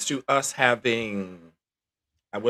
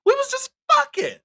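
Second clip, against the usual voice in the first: much higher, louder blurt; blurted punchline; 5 dB louder than usual. Real, not acted. Telling co-host it was only a fling, blurted much higher. Blunt punchline about old hookup.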